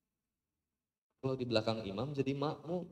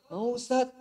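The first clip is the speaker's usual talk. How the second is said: mock girl voice; 9 semitones higher, 3 dB louder than usual. Girl answering ustaz.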